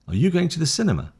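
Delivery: "Are you going to the cinema" asked high and quick; rising question tune. Shown as a demonstration. Teacher repeats the question for learners to copy, rising question tune. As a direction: pitch it up towards "cinema" as a question. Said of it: "Up. It's a question".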